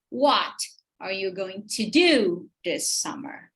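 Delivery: WHAT set apart by a pause, then slow stress on DO; exaggerated sentence stress. Shown as a demonstration. Teacher showing question rhythm, exaggerated stress on What and do. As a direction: punch "What", pause, stretch "do". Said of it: "Eu tô fazendo bem exagerado".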